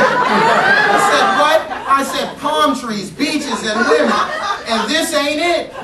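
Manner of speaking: laughing through the words, pitch up; laughing retelling, high and bright. Real, not acted. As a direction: chuckle while telling it.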